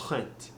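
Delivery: "rent" with an airy H in place of R; French-accented "rent", soft breathy R. Shown as a demonstration. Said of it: "like you're saying this H ahead of it".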